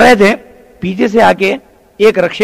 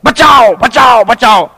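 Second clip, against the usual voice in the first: high, loud repeated cry of a crowd; calling for help; 5 semitones higher, 5 dB louder, much slower than usual. Acted. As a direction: shout out for rescue, acted. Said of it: "ठहर के पुकार रहे हैं". (मदद के लिए पुकारते हुए)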